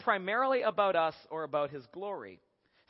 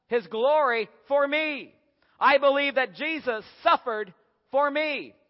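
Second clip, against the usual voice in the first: self-centred proclamation; 5 semitones higher, 6 dB louder, slower than usual. Acted.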